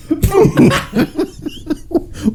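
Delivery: squealing high laugh, voice cracking up; real high laugh. Real, not acted. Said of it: "What is happening?".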